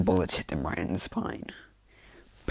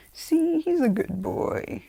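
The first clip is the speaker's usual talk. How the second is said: loud, very high, drawn-out praise; cooing; 4 dB louder, much slower than usual. Acted. Izuku petting the Nomu's face, high slow cooing. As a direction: coo slowly as if to a pet.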